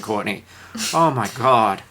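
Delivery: normal talking voice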